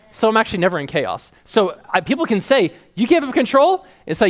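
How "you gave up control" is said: high, rising note of disbelief; incredulous question. Retold or imitated. People challenging someone who gave up control, incredulous. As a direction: question it as if scandalized, pitch lifted.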